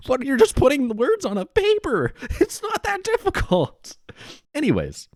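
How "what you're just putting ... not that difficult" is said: very high squeal of disbelief; laughing incredulity. Real, not acted. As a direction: protest in a cracked-up, squeaky voice. Laughing outrage at scammer's excuse.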